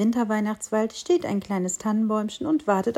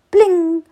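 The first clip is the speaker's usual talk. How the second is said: high, loud bright bling; bling sound; 8 semitones higher, 8 dB louder than usual. A narrator's reading. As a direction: ring it out like a little bell. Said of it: "machte es da auf einmal".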